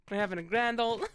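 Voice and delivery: ordinary delivery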